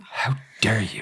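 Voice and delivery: slow whispered indignation; mock-outraged whisper. Co-host echoing her dramatic line back, mock outrage. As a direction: whisper it with theatrical affront. Listener mimics the dramatic phrase.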